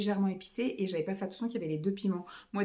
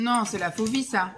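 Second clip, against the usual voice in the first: usual speaking voice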